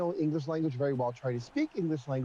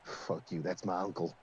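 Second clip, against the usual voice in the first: much lower, softer mock-offended bird; offended bird character; 11 semitones lower, 6 dB quieter than usual. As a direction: grumble it as the insulted bird.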